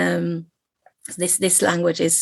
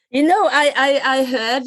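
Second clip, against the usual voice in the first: laughing; 8 semitones higher than usual. Real, not acted. Laughing along with guest.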